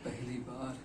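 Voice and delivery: soft, hesitant start; hushed recollection. Acted. Recalling his first longing for freedom, hushed recollection. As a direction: begin quietly, then break off.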